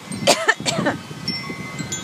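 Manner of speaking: breathy cough, soft; dusty cough. Real, not acted. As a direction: cough from the dust in the air. Coughing in the dust.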